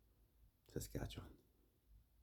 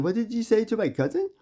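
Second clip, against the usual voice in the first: much higher and louder shouted challenge; angry confrontation; 11 dB louder than usual. Retold or imitated. Cousin confronting the student in gym, shouting. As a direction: yell it at the boy who insulted her cousin. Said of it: "She said".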